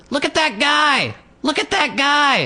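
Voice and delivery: put-on very high, slow toddler squeal; toddler girl. Toddler pointing out a stranger, squeaky excitement. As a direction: shout it like an excited little girl. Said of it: "and she went".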